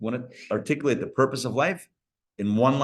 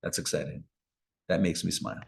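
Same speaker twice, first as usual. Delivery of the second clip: lower, softer, pleased and warm; pleased God; 11 semitones lower, 6 dB quieter than usual. Retold or imitated. God pleased watching a person have a good day, soft and low. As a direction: savor it like a proud father. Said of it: "God says".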